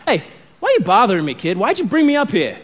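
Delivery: quick, comic New York gripe; New York accent. Acted. Samuel from the dead greets Saul, bothered New York accent. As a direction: play a bothered New Yorker, rising in pitch. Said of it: "he's got a New York accent".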